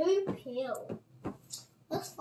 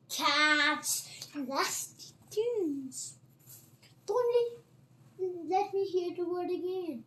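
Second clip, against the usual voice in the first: trembling angry squeak; 5 semitones higher, 3 dB louder, slower than usual. Acted. Trembling mouse rants about cats.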